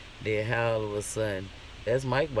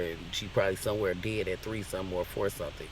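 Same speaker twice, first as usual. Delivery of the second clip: usual speaking voice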